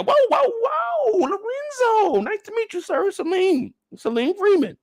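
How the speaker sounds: slow, very high nervous greeting; high frightened voice. Retold or imitated. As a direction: greet him in a terrified squeak.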